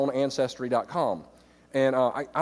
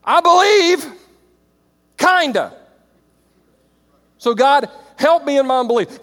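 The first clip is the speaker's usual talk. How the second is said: pleading at the top of the voice, well above the usual pitch; a loud, high plea for more faith; desperate loud prayer; 7 semitones higher, 8 dB louder than usual. Retold or imitated. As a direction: cry out to God half-joking, half-desperate. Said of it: "you're like".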